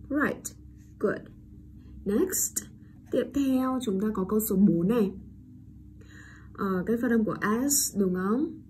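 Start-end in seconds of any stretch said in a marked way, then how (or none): none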